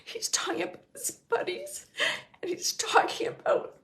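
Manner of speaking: high, tearful whisper; choked up, emotional. Real, not acted. Medium relaying his bond with buddies, tearfully choked up. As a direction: voice giving way to real tears. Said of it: "like, really emotional".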